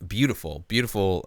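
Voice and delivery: ordinary talk, nothing marked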